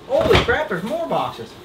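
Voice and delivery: high excited outburst; surprised exclamation. Real, not acted. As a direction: blurt it out amazed. Finding more boxes.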